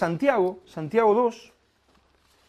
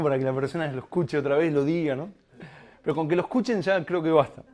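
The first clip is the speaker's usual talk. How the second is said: ordinary delivery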